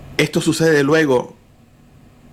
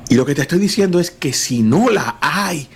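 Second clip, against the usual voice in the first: ordinary talk, nothing marked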